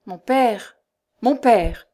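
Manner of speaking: urgent appeal to a father; mildly imploring protest; pleading. A narrator's reading. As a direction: beg him to stop. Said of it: "murmura Tristan d'une voix suppliante".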